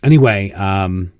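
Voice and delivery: ordinary talk, nothing marked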